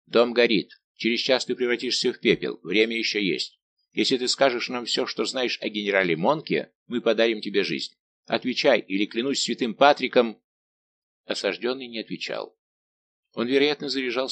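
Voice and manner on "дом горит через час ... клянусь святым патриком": ordinary delivery, nothing marked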